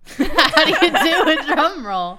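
words bursting out through laughter; laughing. Real, not acted. Laughing after failed drum roll.

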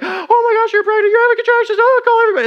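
high, loud excited woman's voice; lady voice. Retold or imitated. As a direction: squeal it out like a frantic woman. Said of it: "that's my lady voice".

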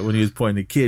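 usual speaking voice

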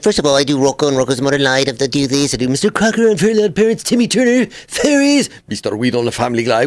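showing off cartoon voices; character voices. Shown as a demonstration. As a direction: run through the character voices. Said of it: "a section of voices".

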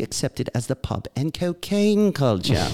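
hammed-up British reading, sing-song swoops; British accent. Co-host reading Englishman's recovery email, hammed-up British accent. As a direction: read it like a theatrical Brit. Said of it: "read it in a British accent".

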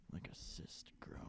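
hushed whisper. Real, not acted. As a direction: whisper it as an aside. Whispered aside during advice.

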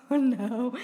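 ordinary talk

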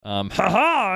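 ordinary talk

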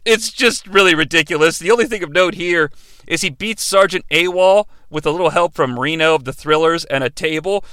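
usual speaking voice

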